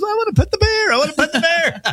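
put-on high little girl whine in a Southern accent. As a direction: beg in a Southern accent like a stubborn toddler. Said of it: "like a Southern accent".

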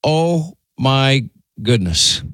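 slow, word by word; drawn-out exasperated emphasis. Real, not acted. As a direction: draw out each word in dismay. Exasperated at co-host being unfiltered.

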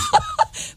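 bright burst of laughter; a short high laugh. Real, not acted. Laughing about British accent.

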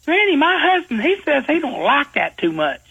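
high, hammed-up country girl; high silly girl greeting granny; young girl voice. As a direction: squeak it out like a goofy young lady. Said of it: "She said".

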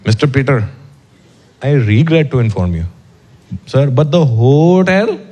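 put-on Indian-accented bellman, slow and formal; polite hotel porter breaking bad news in an Indian accent, slow. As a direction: bellman leaning in to warn the guest, Indian accent. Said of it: "and he goes".

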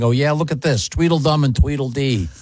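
ordinary talk, nothing marked

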